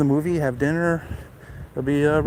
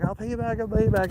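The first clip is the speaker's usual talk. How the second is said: higher, softened pleading imitation; imitating pleading date; 8 semitones higher, 6 dB quieter, faster than usual. Date promises helper repayment, imitated higher pleading. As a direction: quote her hasty promise in a higher voice.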